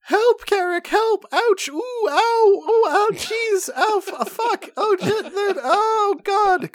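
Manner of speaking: high, panicked halfling yelping in pain; crying halfling. A narrator's reading. Halfling crying out to Carrick while bugs bite him. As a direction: squeal for help as the bugs bite. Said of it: "cries the halfling".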